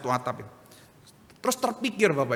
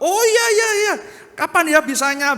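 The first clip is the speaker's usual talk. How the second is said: much higher, louder delighted reply; overjoyed; 12 semitones higher, 8 dB louder than usual. Retold or imitated. Pastor answering the transport official, high and thrilled. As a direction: jump at the offer with delight.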